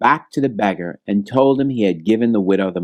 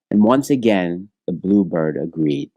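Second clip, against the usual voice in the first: plain reading voice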